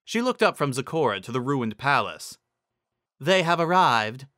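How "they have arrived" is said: a measured, lofty royal voice; regal Celestia. Acted. Celestia announcing to Zecora, lofty and measured. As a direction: speak as the proud sun queen.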